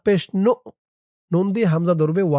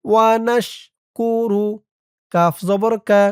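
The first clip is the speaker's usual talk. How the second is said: ordinary talk, nothing marked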